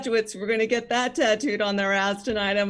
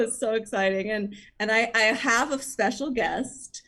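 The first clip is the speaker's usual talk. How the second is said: normal talking voice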